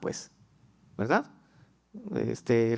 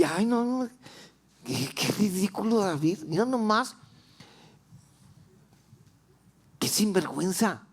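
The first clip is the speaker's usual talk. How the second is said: higher, sneering wife's voice; scornful wife; 5 semitones higher than usual. Pretend. Michal at the window scorning her husband David, sneering. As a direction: scoff like a disgusted wife.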